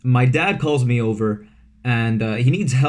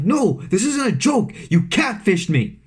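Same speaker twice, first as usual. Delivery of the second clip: angry retort; 7 semitones higher than usual. Retold or imitated. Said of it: "He's like".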